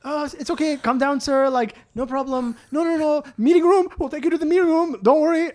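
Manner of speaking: high, soothing mock staff voice; smooth him over in a high fussy tone; placating bank staff. Bank employees calming the angry customer, high and fussy.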